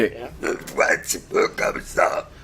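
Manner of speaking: rasping guttural growl. Shown as a demonstration. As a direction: rasp it out from the throat. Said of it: "Elle va se mettre à parler avec une voix gutturale".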